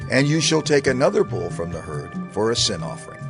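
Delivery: ordinary talk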